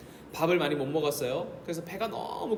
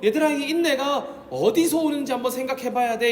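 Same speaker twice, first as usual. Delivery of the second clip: higher, louder pastoral urging; voicing Paul urging; 7 semitones higher, 6 dB louder than usual. Preacher voicing Paul to his flock, higher and louder. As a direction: call out to the children as Paul would. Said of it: "이렇게 말한다는 겁니다".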